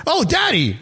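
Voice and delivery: put-on high toddler cry of Daddy; excited child. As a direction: call out like a thrilled little kid. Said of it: "He goes".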